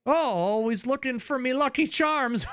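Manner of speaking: high, lilting Irish leprechaun; mock Irish accent. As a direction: tease with a cartoon Irish brogue. Mocks Irishman as cereal mascot.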